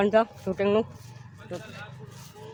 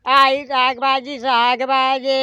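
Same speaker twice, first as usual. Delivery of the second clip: slow drawn-out hawker's cry, pitched up; vegetable seller's call; 4 semitones higher, much slower than usual. Shown as a demonstration. Showing how Vaghoba will call out vegetables, slow hawker cry. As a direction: stretch it out like a street vendor calling. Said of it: "વાઘોબા બૂમો પાડશે".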